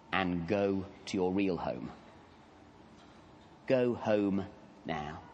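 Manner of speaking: soft, slow, fatherly encouragement; gentle encouragement. Acted. Jesus gently encourages the frightened man to get up and go home. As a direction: coax a wayward child up, softly. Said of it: "the gentle encouragement of a loving father".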